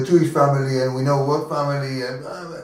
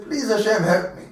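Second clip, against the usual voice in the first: retold plea; 7 semitones higher than usual. Man repeats the prayer aloud.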